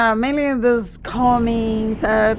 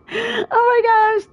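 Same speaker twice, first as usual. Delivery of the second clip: high, drawn-out, emotional exclamation; overwhelmed; 10 semitones higher, much slower than usual. Real, not acted. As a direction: draw it out slowly, overcome by the win. Overwhelmed after winning the game.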